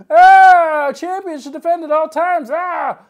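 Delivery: high yelled outburst, framed by roars; angry fan. Pretend. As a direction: rant as a hammed-up outraged wrestling fan. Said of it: "an angry fan action figure".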